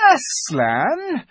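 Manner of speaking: high cry turning to threat; imperious, suspicious demand; alarmed queen. A narrator's reading. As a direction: start high in shock, then settle into warning. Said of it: "cried the Queen".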